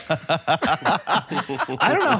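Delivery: laughing it out, slow; can't answer for laughing; laughing through the words. Real, not acted. Laughing disbelief at the Bronco.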